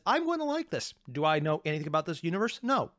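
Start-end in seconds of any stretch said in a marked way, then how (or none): 0.0-0.9 high eager voice; excited. Retold or imitated. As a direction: chirp it happily. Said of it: "that phrase automatically says".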